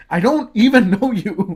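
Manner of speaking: exasperated. Retold or imitated. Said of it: "saying out loud to myself".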